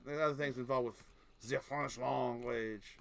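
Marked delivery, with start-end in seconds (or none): 1.5-3.0 slow, exaggerated French accent; hammed-up French-accented English. Shown as a demonstration. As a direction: drag it out with a thick French accent. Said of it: "to make it a French accent".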